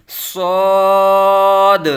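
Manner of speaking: صاد very long, loud and thick, with a sharp whistle-like s; full-mouthed whistling Saad. Shown as a demonstration. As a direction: sustain a heavy, rounded صاد on a level tone. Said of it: "پر یعنی موٹا کر کے پڑھیں گے".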